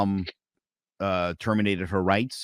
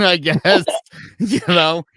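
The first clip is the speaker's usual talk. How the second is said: laughing through it, louder; 7 semitones higher, 6 dB louder than usual. Real, not acted. Telling the guest about Georgia, laughing. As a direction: laugh out the words.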